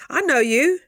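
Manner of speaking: loud, high, teasing I know you. Shown as a demonstration. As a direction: call them out with a grin.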